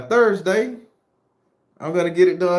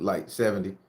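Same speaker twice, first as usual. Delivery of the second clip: usual speaking voice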